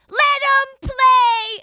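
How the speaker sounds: put-on high, drawn-out kid's heckle at full volume; Gabby. Six-year-old heckles the refs, loud high imitated child yell. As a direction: yell it slowly like a tiny outraged fan. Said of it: "Six years old".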